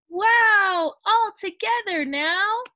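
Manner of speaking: high, loud wow; rally everyone in a thrilled shout; joyful high call to dance; excited frog cry. A narrator's reading. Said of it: "Frog cried".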